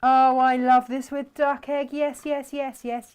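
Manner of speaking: loud, high eager yeses; excited. Real, not acted. Enthusing over duck egg.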